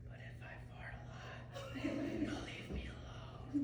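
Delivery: breathy retold whisper, slowed right down. Second grader whispering his plan to the teacher, slow whisper. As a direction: lean in and whisper it slowly like a secret. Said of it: "he leans in more and he whispers".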